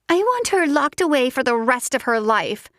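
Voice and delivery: high, clipped fury held under the breath; seething. Acted. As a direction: spit it out through rage. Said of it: "she was seething".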